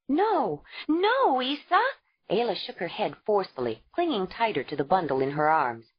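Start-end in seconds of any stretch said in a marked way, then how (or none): 0.1-1.8 higher, cried out in panic; frantic no. A narrator's reading. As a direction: clutch the baby and refuse. Ayla refusing to give baby.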